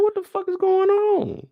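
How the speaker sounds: high, slow put-on voice of disbelief; bewildered. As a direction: stretch it out, baffled. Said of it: "I'm like".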